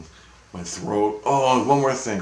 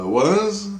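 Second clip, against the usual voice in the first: astonished question; 12 semitones higher, 4 dB louder than usual. Retold or imitated. Reacting to Christ's revelation, astonished. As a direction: pitch swooping high in surprise.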